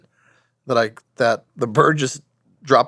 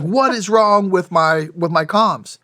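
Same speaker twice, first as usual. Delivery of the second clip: high, slow, mock-panicked question; imitating a rattled teammate, higher and drawn out; freaking out; 6 semitones higher, 3 dB louder, slower than usual. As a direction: play a buddy panicking over his broken radio. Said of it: "now he's freaking out".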